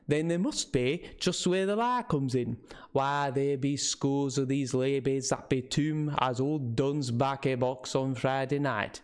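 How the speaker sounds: put-on old local man's northern English with Scots touches; rustic northern English, slipping toward Scottish; northern English dialect. Old man scoffing to visitors about warnings, northern English dialect. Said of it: "did not quite understand his dialect".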